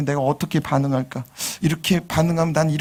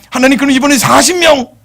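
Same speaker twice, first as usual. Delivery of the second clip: high, loud and cheeky; pushy bargaining; 11 semitones higher, 8 dB louder than usual. Acted. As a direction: press for a better deal. Abraham bargaining further.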